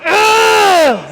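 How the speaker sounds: loud high effort groan; straining groan. Real, not acted. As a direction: groan hard through the rep. Lifting heavy, straining.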